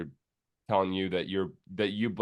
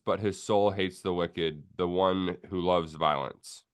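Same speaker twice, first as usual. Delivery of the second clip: scripture reading; 3 semitones lower, slower than usual. Acted.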